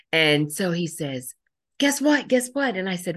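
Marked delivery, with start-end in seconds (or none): 1.8-2.7 much higher and louder, bursting eagerness; can't-wait-to-tell-you urgency; excited. Retold or imitated. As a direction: kid bouncing with news. Boy eager to share news.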